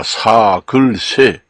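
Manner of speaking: 사글세 slowly, syllable by syllable; 사글세 stretched out as a model pronunciation; standard spelling reading. Shown as a demonstration. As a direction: say sa, geul, se for 사글세. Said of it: "표기 방법으로 하면은 사글세입니다".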